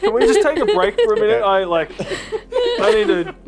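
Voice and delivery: laughing, can barely get words out; gasping between laughs; after laughing hard. Real, not acted. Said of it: "I need to collect myself".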